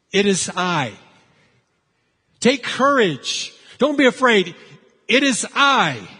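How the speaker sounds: loud, emphatic declaration in short bursts; retold crying out. Jesus naming himself to terrified disciples, crying out. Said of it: "Jesus cries out".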